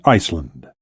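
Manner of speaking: usual speaking voice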